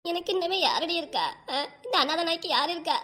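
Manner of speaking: tearful self-pitying lament; plaintive, weepy plea; acted crying. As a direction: wail it as an abandoned mother. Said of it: "அக்கா, அழாதக்கா".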